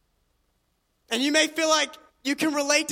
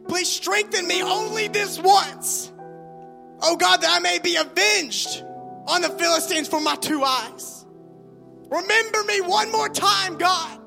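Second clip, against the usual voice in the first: crying out to God; 4 semitones higher than usual. Acted. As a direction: beg God at full voice. Samson's prayer voiced by preacher.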